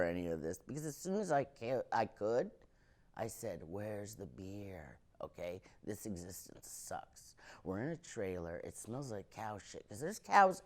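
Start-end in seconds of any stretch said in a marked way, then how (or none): 3.7-7.3 slow breathless whisper. Retold or imitated. Baby comic wanting beer, whispered aside. Baby self voiced as wiser.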